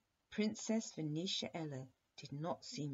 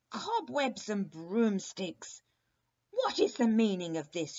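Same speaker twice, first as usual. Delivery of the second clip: furious witch; 6 semitones higher, 11 dB louder than usual. A narrator's reading. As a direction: roar it at them like an outraged witch. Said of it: "she cried furiously".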